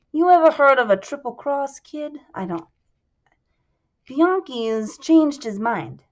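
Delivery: lightly voiced Italian-American mobster. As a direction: lean in like a gangster sharing a secret. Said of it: "I'm still doing his accent".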